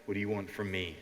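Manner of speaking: low, quiet, unwilling grumble; uncooperative partner. Acted. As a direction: play the reluctant partner, low and soft. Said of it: "don't be like".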